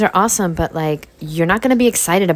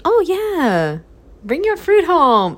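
put-on high sweet girlfriend voice; imitating girlfriend; 12 semitones higher, slower than usual. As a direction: mimic her sudden approval. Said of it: "now she".

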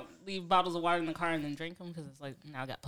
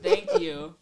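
laughing; 12 semitones higher than usual. Real, not acted. Laughing thanks to Twitter.

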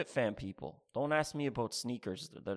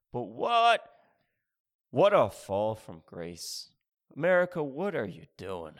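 mock lament; 7 dB louder than usual.

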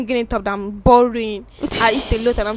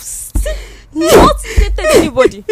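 laughing out the words; 11 semitones higher, 4 dB louder, slower than usual. Real, not acted. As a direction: laugh through the confession. Laughs at own confession.

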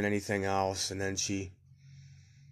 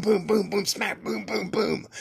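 high-pitched rapid-fire punch noises; imitated punches; 10 semitones higher, slower than usual. Dad beating up the teacher, imitated punch noises. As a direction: act out blow after blow in a high voice. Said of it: "like that".

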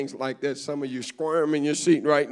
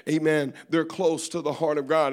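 ordinary talk

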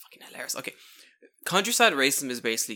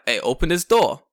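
loud, higher London demand; shouted London street confrontation; 4 semitones higher, 10 dB louder than usual. Acted. Man confronting panicking kids in the car, loud London voice. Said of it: "Let's get my London voice ready".

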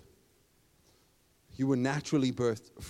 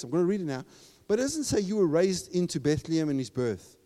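usual speaking voice

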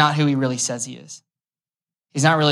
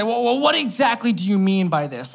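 higher-pitched mock challenge; challenging debater; 5 semitones higher than usual. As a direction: question it like a debater dodging the point. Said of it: "when two people are arguing or they're in a debate".

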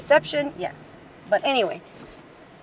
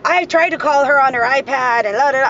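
imitating his huffy excuse, louder; huffy and puffy; 3 semitones higher, 7 dB louder than usual. Ex complains he tried calling their daughter, huffy. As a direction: puff it up like an indignant ex. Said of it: "he's all hussy, huffy and puffy".

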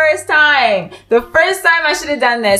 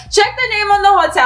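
high and fast; 9 semitones higher, much faster than usual. Retold or imitated. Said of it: "I'm like".

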